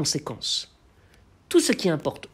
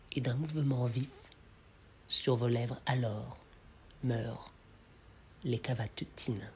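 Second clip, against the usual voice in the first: poem recitation; 2 semitones lower, 4 dB quieter than usual. Acted. Lecturer reads the passing demoiselle verses, hushed poem recitation. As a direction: let the verse die away, pausing between phrases. Recites the poem's third chapter.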